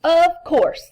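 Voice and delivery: high, loud eager reply; a lively hare answering. Acted.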